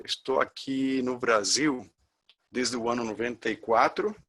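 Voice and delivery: normal talking voice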